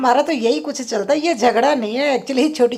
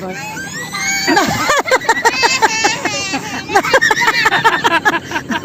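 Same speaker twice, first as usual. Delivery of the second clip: shrieking laugh; 11 semitones higher than usual. Real, not acted. Shrieking with laughter as the family teases her.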